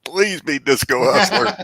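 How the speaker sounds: high, loud and slowed begging; excited pleading. Real, not acted. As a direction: plead like your wish depends on it. Begging for a favourite answer.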